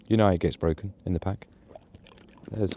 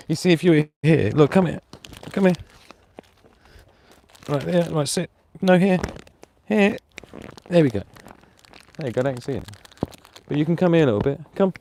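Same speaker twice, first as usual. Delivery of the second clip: louder, higher firm call; raised, higher command to the dog; 7 semitones higher, 5 dB louder than usual. Real, not acted. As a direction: summon the dog to a spot. Directing dog into shot.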